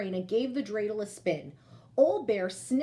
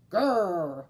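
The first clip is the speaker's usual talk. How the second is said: happy bear growl; 4 semitones lower, 4 dB louder than usual. Acted. Bear thanks for the scarf.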